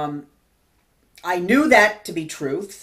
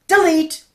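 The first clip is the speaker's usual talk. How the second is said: "delete" punched high and loud, falling; emphatic command; 9 semitones higher, 5 dB louder than usual. Acted. Preacher urging viewers to erase old hurts, loud high "delete". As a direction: hit "delete" like pressing the key.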